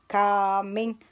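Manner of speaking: ordinary delivery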